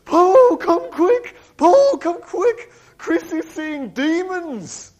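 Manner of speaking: high, loud, drawn-out panicked calling; frantic call. Retold or imitated. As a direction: shout for help in terror at the door. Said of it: "She was going".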